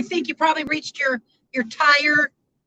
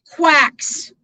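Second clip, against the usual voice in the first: "quacks" punched out louder on its own; absolute emphasis; 5 dB louder than usual. Real, not acted. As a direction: land hard on "quacks" after a pause. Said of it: "I say that with absolute emphasis".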